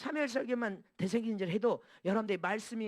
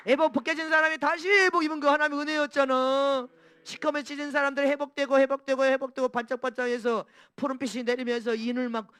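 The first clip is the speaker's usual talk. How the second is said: loud, high impassioned preaching; fiery preaching; 5 semitones higher, 8 dB louder than usual. Acted. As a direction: preach it with full fire. Preaching at full force.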